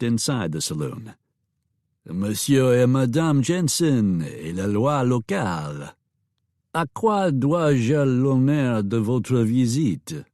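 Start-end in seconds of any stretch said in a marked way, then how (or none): 2.1-10.2 slow, courtly French host greeting guests; slow, gracious French welcome. Acted. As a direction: welcome them like a gracious French saloon owner.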